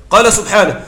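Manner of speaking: ordinary delivery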